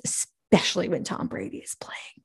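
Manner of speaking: a breathy, hushed whisper; whispered aside. Real, not acted. Fan whispering about her favourite quarterback. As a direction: whisper it like a secret crush.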